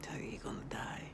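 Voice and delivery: low, whispered death threat; imitating a menacing whisper. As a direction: hiss it like a sinister stalker. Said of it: "using this menacing voice".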